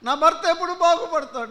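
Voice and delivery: loud, high mock wail of a wife. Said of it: "భార్యలు విలపిస్తున్నారు". (భర్త కోసం విలపించే భార్య, గట్టిగా ఎత్తైన స్వరంతో)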